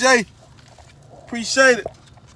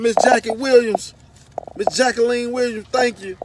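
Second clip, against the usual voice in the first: loud thanks; calling out; 2 semitones higher, 8 dB louder than usual. Real, not acted. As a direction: call the thanks across the room.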